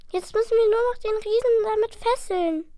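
high, lively plan; eager little dragon. Acted. Dragon proposing a plan to his brother, high. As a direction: pitch the clever idea.

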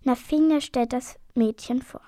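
ordinary delivery

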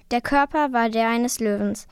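usual speaking voice

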